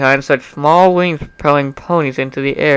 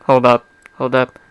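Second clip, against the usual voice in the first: plain reading voice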